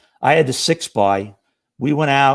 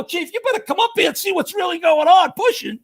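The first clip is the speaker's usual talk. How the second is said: much higher mock shout down the stairs; retold shout. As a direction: call the chief up urgently. Rookie yells down to chief.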